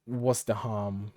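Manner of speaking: ordinary talk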